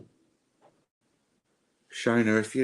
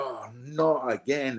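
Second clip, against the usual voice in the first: slow, higher-pitched exasperated groan; acted frustration; 4 semitones higher, slower than usual. Psychic voicing the client's feelings, acted frustration. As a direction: drag out the opening in weary frustration. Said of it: "that frustration".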